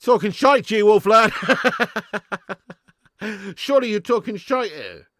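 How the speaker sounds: loud, high indignant yell at the chat; full-throated football-fan shout; outraged shout. Real, not acted. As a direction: bellow it in disbelief.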